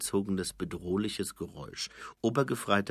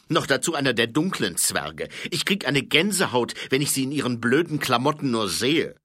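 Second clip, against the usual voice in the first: put-on louder, disgusted old sergeant; grumbling sergeant; 6 semitones higher, 8 dB louder than usual. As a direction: sneer at the dwarfs' clothes. Colon grumbles about dwarfs.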